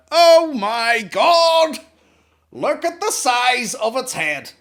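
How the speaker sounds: very high, drawn-out bellow of amazement; huge mock-astonished shout; shocked yell. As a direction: yell it slowly in hammed-up horror. Retold shock at baby's head.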